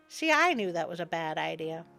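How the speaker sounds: ordinary delivery, nothing marked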